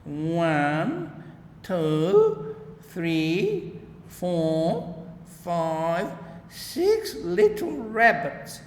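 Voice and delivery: put-on gleeful old farmer counting, rising to a high drawn-out six; sly old gardener gloating over his catch; Mr McGregor counting. A narrator's reading. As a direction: count them up with a chuckle in the voice. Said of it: "hear him drag his chair on the flags and chuckle".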